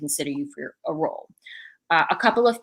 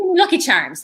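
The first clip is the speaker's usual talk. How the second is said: high-pitched mock Irish accent; playful, badly faked Irish lilt; 9 semitones higher, 4 dB louder than usual. Shown as a demonstration. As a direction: slip into a hammed-up Irish accent. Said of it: "get into an Irish accent".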